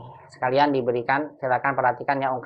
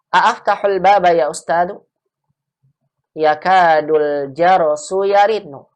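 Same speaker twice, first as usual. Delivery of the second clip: clear, slightly louder textbook reading voice; model dialogue reading; 5 semitones higher, 6 dB louder than usual. Acted. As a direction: read the dialogue slowly and clearly as a model for students. Teacher reads Arabic dialogue aloud. (membaca percakapan dengan jelas sebagai contoh)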